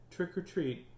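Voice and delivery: small, soft high voice. A narrator's reading. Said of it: "so he said very softly".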